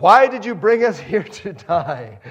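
loud, whiny accusing wail; complaining Israelites. Retold or imitated. Israelites accusing Moses of bringing them to die, loud whine.

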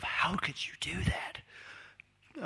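slow, hushed disapproving whisper; judging whisper. Retold or imitated. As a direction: whisper the judgment slowly. Judging stares at communal toilet.

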